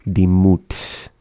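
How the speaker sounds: Dimut with the T clearly sounded before the S. Shown as a demonstration. Teacher modelling the T for a learner, Dimut with T still sounded. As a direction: keep the T in Dimut, then let the s hiss. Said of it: "the one with the T".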